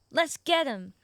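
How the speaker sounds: loud rallying shout; rally your mount to charge; battle cry. Acted.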